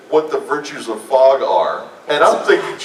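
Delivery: ordinary talk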